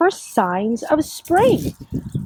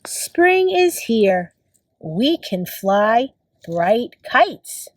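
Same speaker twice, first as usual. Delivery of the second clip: slow, measured picture-book reading; reading to children; slower than usual. Acted. Teacher reads a spring book to kids, slow read-aloud. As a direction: read slowly, each word spaced out for young children.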